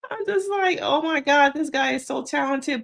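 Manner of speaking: high-pitched, talking through laughter; laughing. Real, not acted. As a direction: squeal it out while cracking up. Laughing at the acted scene.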